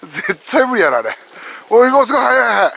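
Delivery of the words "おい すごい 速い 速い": loud, higher-pitched live reaction; excited shout while watching; excited amazement. Real, not acted. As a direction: call out in real amazement at the speed. Watching someone race up stairs.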